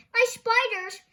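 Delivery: high, loud anguished cry; cried out. Acted. Thorndyke crying out as his spiders escape, loud. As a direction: cry out in despair. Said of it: "he cried".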